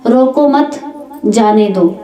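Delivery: "रोको मत" as one phrase, then जाने दो; group रोको मत together, break, let जाने दो fall; pause after मत. Shown as a demonstration. Preacher showing listeners how a pause shifts meaning, pause after मत. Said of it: "तो हम क्या बोलते हैं".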